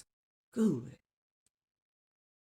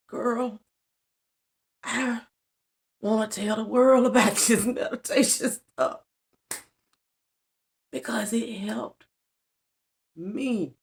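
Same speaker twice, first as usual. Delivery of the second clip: crying through it; 5 semitones higher than usual. Real, not acted. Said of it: "y'all know I'm a crybaby".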